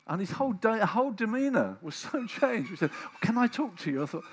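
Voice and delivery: fervent excited sermon; loud preaching. Acted. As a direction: lift the room with joy. Preaching at full force.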